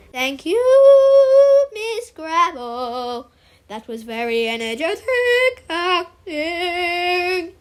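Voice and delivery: very drawn-out, singsong drama teacher; theatrical drama teacher. Acted. Drama teacher setting the giant warm-up, theatrical singsong. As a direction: sing the words out, stretching every vowel.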